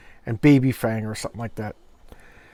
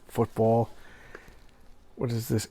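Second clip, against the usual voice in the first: ordinary delivery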